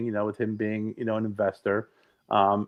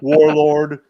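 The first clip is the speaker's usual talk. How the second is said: mock Clinton; 3 semitones higher, 9 dB louder than usual. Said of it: "he's eating food going".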